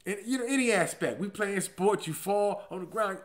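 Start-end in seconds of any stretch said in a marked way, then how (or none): none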